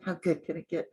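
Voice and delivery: grudging, grumbled mock reluctance; mock cranky. Cranky person seeing the reminder note, mock grudging. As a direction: grumble it out as if in a bad mood. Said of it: "even if you're cranky".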